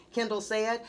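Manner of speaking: ordinary delivery, nothing marked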